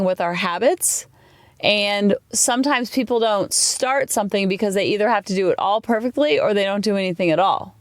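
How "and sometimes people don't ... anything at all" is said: normal talking voice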